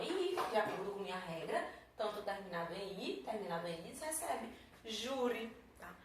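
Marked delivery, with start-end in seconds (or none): none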